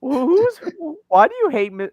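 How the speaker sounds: put-on high, loud stammered kid's question; imitated pupil. Pupil challenging teacher about a feud, high loud imitation. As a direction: pipe up loudly as a confused kid.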